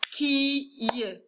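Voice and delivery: Qui y as two drawn-out parts with a pause; split into syllables. Shown as a demonstration. Teacher counting syllables for pupils, Qui y split in two. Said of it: "On va compter ensemble les syllabes".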